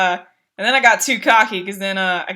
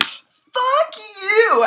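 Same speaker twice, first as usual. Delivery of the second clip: crying mock curse; 11 semitones higher than usual. Shooting at the boss angrily.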